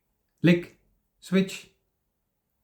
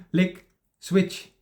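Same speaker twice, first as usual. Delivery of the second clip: normal talking voice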